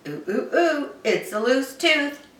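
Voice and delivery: lightly voiced dentist. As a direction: say it like a dentist who has a plan.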